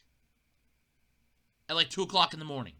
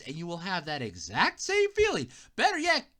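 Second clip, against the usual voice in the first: ordinary talk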